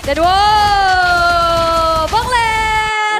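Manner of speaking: loud, high hype shout; excited shout. Real, not acted. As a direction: yell it out in disbelief. Caster erupts at big play.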